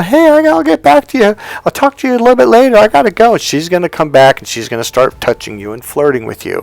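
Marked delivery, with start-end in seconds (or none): 0.0-3.3 high, loud and fast imitated woman; mock girlfriend hanging up on her friend; hurried woman on phone. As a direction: rush her off the call in a high voice.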